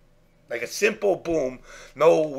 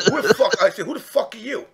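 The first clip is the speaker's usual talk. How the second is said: hostile, raised and higher bark; angry confrontation; 6 semitones higher, 4 dB louder than usual. Retold or imitated. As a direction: square up and demand who he is. Confronting a stranger at event.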